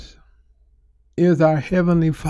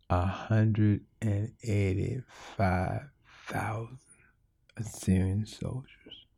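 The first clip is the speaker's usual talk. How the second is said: slow, sinking to a whisper; awed whisper; 7 semitones lower, 11 dB quieter, slower than usual. Acted. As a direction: let the number fall to a whisper. Awe at the number.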